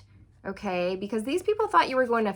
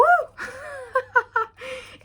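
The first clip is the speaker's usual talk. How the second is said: high giddy laughter; delighted laughing; 3 dB louder than usual. Real, not acted. Laughing after cheering.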